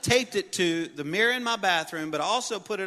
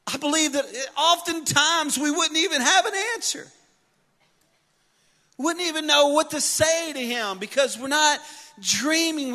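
high, loud preacher's voice; preaching; 11 semitones higher, 6 dB louder than usual. Acted. Pastor preaching to the church, loud and high. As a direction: preach it out to the congregation.

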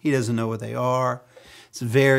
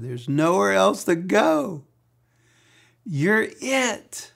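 slow, higher, heartfelt plea; earnest devotion; 8 semitones higher, 4 dB louder, slower than usual. Acted. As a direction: say it like you have nowhere else to turn. Peter's devoted reply to Jesus.

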